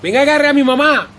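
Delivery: loud, high call across a crowd. Acted. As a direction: shout to get her attention. Calling aunt across the funeral.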